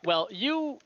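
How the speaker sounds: ordinary talk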